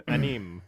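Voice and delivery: "Anime" as an-EEM, through a laugh; echoed mispronunciation. Retold or imitated. Echoing a friend's joke, pronouncing "Anime" an-EEM with laughter. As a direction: repeat "Anime" as two syllables, an-EEM, amused.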